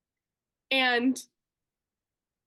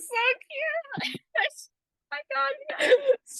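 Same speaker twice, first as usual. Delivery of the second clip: high thrilled yes; squealing joy; 12 semitones higher, much slower than usual. Real, not acted. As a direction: gush like a starstruck fan. Excited gushing.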